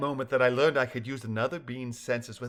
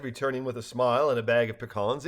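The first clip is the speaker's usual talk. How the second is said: ordinary delivery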